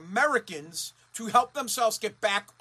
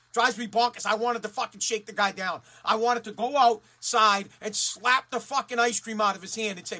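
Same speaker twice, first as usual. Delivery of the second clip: ordinary talk, nothing marked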